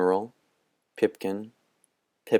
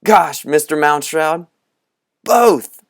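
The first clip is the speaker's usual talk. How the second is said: loud, much higher excited burst; 8 semitones higher, 8 dB louder, slower than usual. A narrator's reading. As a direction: let the held breath explode. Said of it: "burst it out".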